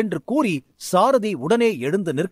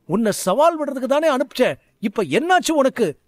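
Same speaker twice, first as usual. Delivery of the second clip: higher, urgent questions; rattled, demand what happened; a shaken teacher questioning his hurt student; alarmed; 6 semitones higher than usual. Acted. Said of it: "சற்று பதட்டத்துடன் சாரதி கேட்டான்".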